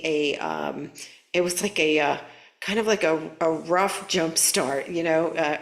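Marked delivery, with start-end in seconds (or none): 3.4-4.9 rough drawn out slowly and leaned on; stress on rough. Shown as a demonstration. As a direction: lean hard on the word rough. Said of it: "emphasis on the word rough".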